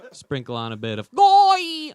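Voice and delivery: "Goy" said very high and slow; mock authentic Thai. Showing off his Thai to the waiters, high slow Goy. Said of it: "as they say, authentically".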